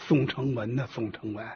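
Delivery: low, quiet musing to oneself; inner thought. A narrator's reading. Station chief thinks about Song Chengwen, hushed inner musing. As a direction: drop low and soft, as if thinking privately. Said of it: "心想啊".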